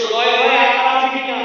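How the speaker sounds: ordinary talk, nothing marked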